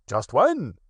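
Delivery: a hint of Tigger squirming; uncomfortable Tigger. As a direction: Tigger dodging the question. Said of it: "said Tigger a little uncomfortably".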